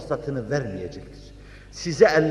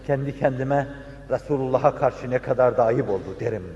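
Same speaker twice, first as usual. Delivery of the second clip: ordinary delivery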